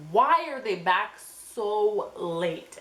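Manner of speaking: slow, drawn-out disbelief; slow puzzled question; incredulous. Retold or imitated. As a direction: stretch it out in disbelief. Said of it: "So I'm like".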